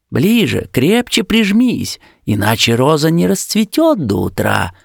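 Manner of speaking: urgent high-pitched demand; insist, pitch climbing high. A narrator's reading. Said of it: "потребовал опять куст".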